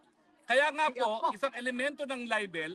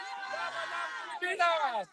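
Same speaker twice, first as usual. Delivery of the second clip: shouted cheer; 7 semitones higher, 3 dB quieter than usual. Acted. Rally cheer.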